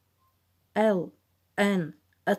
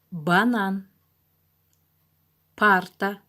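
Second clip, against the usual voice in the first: reading a word list; slower than usual. Shown as a demonstration. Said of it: "Повторяйтесь вместе со мной".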